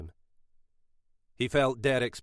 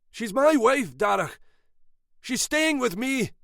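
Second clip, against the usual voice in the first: angry, much higher, louder outburst; losing it; 14 semitones higher, 6 dB louder than usual. A narrator's reading. Husband losing his temper at her brother, furious. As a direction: snap and claim her as yours. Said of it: "Derek lost it".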